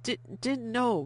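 stammered, upset denial; stammering. A narrator's reading. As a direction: stumble out the denial. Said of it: "Vianne stammered".

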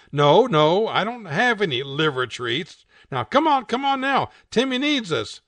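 high, loud impatient coaxing; impatient TV owner. Acted. Owner refusing liver treats and urging the cat, loud impatience. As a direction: hurry the lazy cat along.

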